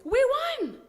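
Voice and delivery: high, cheering shout; excited shout. Acted. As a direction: cheer the win. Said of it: "shouted the boys".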